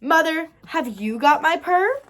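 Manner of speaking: kitten's eager call. A narrator's reading. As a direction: call out to mother with the question. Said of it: "to call out her question".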